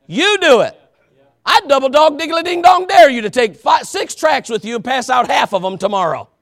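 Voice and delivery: high, loud pulpit shout; fiery, shouted dare from the pulpit; full-force preaching. Real, not acted. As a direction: belt out the challenge to the congregation.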